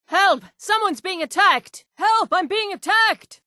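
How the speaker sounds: high frightened cries for help; panicked high calls for help; shouting for help. Acted. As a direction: yell to anyone nearby, terrified. Victim calling out for help.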